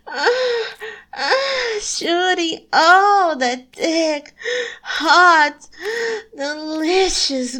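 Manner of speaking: loud, breathless and intense; climactic moaning. Acted. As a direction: push it out at the peak. Climax moments, much louder.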